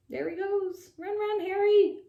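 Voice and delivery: high excited urging; root for him to escape; cheering on. Acted. Narrator cheering the dog on, high and excited.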